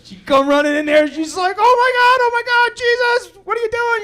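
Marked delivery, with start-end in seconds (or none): 1.6-4.0 panicked wife. Retold or imitated. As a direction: shriek it in a panic.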